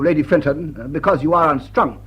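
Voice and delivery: ordinary delivery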